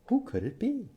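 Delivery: high, soft wondering question; curious wonder. A narrator's reading. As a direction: build mystery for the kids. Wondering who rings the doorbell.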